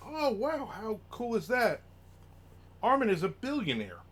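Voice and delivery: high, amazed mock fan voice; mock gushing fan. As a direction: gush like an impressed fan. Imagined fan gushing about wealth.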